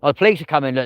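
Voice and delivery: ordinary delivery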